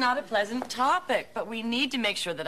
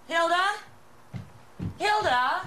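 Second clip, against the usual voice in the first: raised, high questioning call; searching shout for someone out of earshot; 8 semitones higher than usual. Acted. Calling for missing sister.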